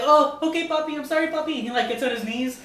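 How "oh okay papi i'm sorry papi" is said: high, begging mock plea; pleading guy. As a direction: grovel in a higher voice. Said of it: "And the guy's like".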